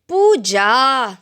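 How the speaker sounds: loud, drawn-out scolding call. A narrator's reading. Mother to daughter who dropped the cup, scolding. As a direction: scold the child by name. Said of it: "scolded my mother".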